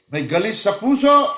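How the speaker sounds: sudden high, loud cry on puso; fiery preacher's cry; preaching shout. Acted. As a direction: shout it out to the congregation. Preacher contrasts inner and outer.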